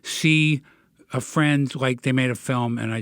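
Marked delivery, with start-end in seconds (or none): none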